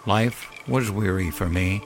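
ordinary delivery, nothing marked

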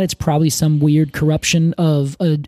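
ordinary talk

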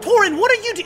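high, loud, cut-off exclamation. Acted. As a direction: blurt the name out loudly. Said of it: "he just very loudly goes".

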